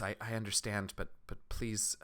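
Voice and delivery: soft, stammered plea; subdued, faltering villager; pleading villager. Acted. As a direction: plead quietly and hesitantly. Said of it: "She says".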